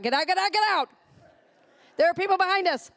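hammed-up parent hurrying a dawdler; mock yell. Mother retelling hurrying her son out of the car, mock yell. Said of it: "And I'm saying".

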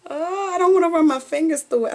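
high, delighted; a high eager gush; excited. Real, not acted. Thrilled, touching the hair.

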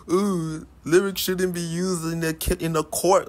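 high, whiny mocking rapper voice. Mocking a rapper in court, high and whiny. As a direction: mimic a rapper pleading innocence. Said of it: "be like".

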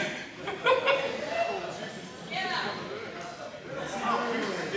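big high laugh, breathy; hearty laugh. Real, not acted. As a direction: burst out laughing at the story. Laughs at own story.

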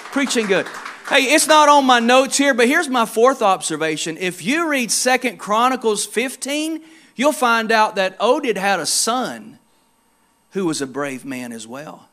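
usual speaking voice

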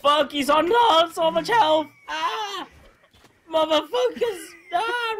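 high, loud frantic wailing; panicked screaming. Real, not acted. Player losing a fight to a healthy enemy, panicked screaming. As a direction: scream in frustration mid-fight.

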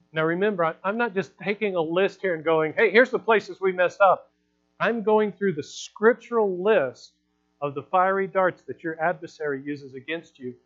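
loud, driving sermon delivery; preaching at full force. Acted. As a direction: preach it out to the whole church. Preaches loudly to congregation.

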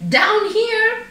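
high, loud cub shout; little panda calling out loud; playful shout. A narrator's reading. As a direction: shout it high as you tumble down. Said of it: "he shouted as he fell".

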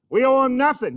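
loud, high "We owe 'em nothing" as a confident wrong answer; wrong view, stated loud. Shown as a demonstration. Pastor voicing a wrong answer to his church, loud and sure. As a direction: say "We owe 'em nothing" boldly, like a claim about to be corrected. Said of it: "You're wrong".

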